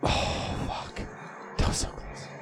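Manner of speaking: breathed out, almost whispered; hushed reaction. Real, not acted. Player after a near miss, hushed and soft. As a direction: let it out under the breath.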